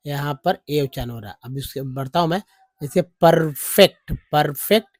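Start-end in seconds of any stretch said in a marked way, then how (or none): 2.8-4.9 परफेक्ट said slowly and loud, rising, like "perfect"; slow loud demo. Shown as a demonstration. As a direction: stretch परफेक्ट for the class. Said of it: "परफेक्ट में ई का उच्चारण अ". (परफेक्ट को धीरे और ज़ोर से)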